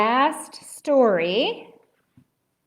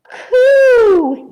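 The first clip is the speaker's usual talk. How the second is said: loud, high drawn-out wail; 14 semitones higher, 14 dB louder than usual. A narrator's reading. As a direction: wail it out high like a little ghost. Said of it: "he wailed".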